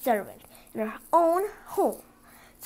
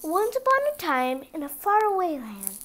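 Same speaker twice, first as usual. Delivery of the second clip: bright, lilting fairy-tale start; storybook opening; 4 semitones higher, 3 dB louder than usual. A narrator's reading. Narrator begins the fairy tale for a child, lilting. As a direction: open the tale like reading to children.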